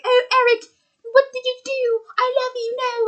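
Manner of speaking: high, breathy falsetto mock lament; hammed-up falsetto heartbreak; mock girlfriend lament. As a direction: wail it like a melodramatic heroine. Imitating girlfriend's distraught cry.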